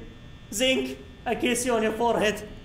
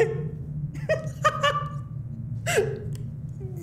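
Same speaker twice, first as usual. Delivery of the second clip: high breathless cackle; wheezing laugh; 5 dB quieter than usual. Real, not acted. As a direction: laugh till the voice goes. Laughing hard after win.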